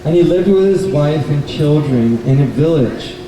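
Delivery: ordinary delivery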